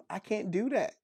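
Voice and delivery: normal talking voice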